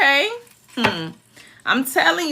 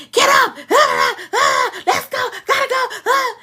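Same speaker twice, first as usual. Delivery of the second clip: high-pitched hollering wake-up call; mock yelling; 12 semitones higher than usual. Mother re-enacting how she woke her children, yelling. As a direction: imitate a parent yelling the kids awake. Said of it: "yelling and screaming at them".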